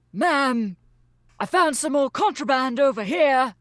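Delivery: put-on high, loud soldier in a Solonese accent. Soldier reporting a find to the captain, loud Solonese accent. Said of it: "soldier say in a Solonese accent".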